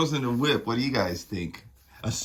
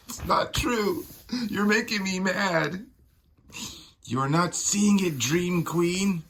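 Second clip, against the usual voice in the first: high, strained, upset protest; upset denial; 9 semitones higher than usual. Acted. Witch answering the Dream Queen's taunts, high upset protest. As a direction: push back, hurt and furious.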